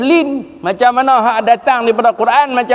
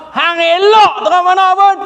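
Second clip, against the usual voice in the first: high-pitched, insistent dismissal; emphatic dismissal; 10 semitones higher than usual. Acted. Preacher rejects any teaching against Quran and sunnah, emphatic dismissal. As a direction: pitch it right up, waving the argument away. Acted firm refusal of persuasive explanations.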